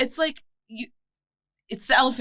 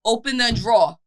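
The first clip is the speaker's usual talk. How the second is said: put-on Brooklyn accent; 4 semitones higher, 4 dB louder than usual. Mocking how Brooklyn people ask for the drawer, Brooklyn accent. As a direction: say drawer as draw, Brooklyn style. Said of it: "people are like".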